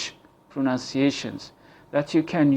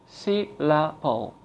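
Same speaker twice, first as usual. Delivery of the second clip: slow and clearly split into beats; slow syllable demo; 3 dB quieter, slower than usual. Shown as a demonstration. Teacher shows learners how to say it slowly. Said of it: "Say the word slowly".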